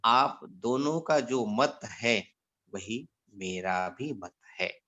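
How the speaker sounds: lightly voiced guru, slow and low; measured, low reply, softening toward the end; a hint of an unhurried teacher giving the verdict; guru's answer. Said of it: "पूजनीय गुरुजी ने कहा".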